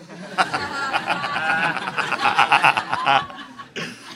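long, helpless run of laughter. Real, not acted. Preacher amused by blind man's answer, long laugh. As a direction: keep laughing for several seconds. Laughs at his own punchline.

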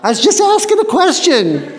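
high, laughing mock-innocent reply; hammed-up innocent little brother; innocent protest. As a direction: play it wide-eyed, all innocence, through a laugh. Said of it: "his brother's yelling at him, he goes".

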